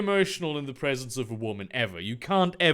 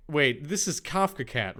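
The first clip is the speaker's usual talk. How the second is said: usual speaking voice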